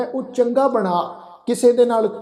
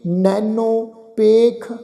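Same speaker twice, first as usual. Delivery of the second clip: very slow, drawn-out Gurbani recitation; scripture recitation; 3 semitones higher, much slower than usual. Acted. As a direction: recite each phrase slowly, holding the long vowels. Recites scripture between explanations.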